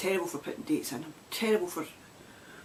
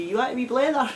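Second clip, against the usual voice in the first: Scots phrasing; 7 semitones higher, 5 dB louder than usual. Shown as a demonstration. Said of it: "we'll say".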